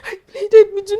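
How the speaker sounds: breaking into tears on the repeat; crying plea. Acted. Mother to husband, plea breaking into sobs. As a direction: sob out the plea.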